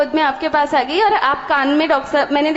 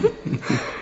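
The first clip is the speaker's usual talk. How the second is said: a soft voiceless little laugh; quiet laugh; 5 semitones higher, 9 dB quieter than usual. Real, not acted. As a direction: laugh quietly to yourself mid-story. Laughs before answering husband. (धीमी सी हँसी)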